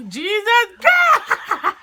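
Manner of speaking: drawn-out shouted Jesus Christ; loud exclamation. Real, not acted. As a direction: yell it slowly, laughing. Spicy ramen challenge, burning reaction.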